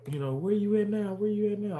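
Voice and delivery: high, childlike mock voice; lightly voiced little boy asking; mimicking the little one. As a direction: chirp it like an eager kid on the phone. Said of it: "he be calling and".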